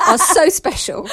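cracking up mid-sentence; laughing. Real, not acted. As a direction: laugh through the words. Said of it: "funniest thing you've ever said".

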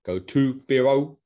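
mock Spanish accent, tacking O on the end. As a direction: drag out Beero in a silly tourist Spanish accent. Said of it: "I don't know why I'm putting that accent on".

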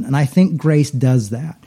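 ordinary talk, nothing marked

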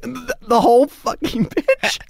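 can't believe the request; mock-appalled; incredulous. Disbelief at repeating the pitch.

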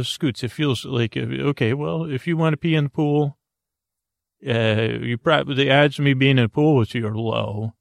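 ordinary talk

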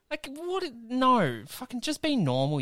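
high-pitched exasperated mock rant; over-the-top rant. Telling off the celebrity with the deer jerky, high mock exasperation. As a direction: pitch up in hammed-up disbelief. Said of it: "that was a bit too hard".

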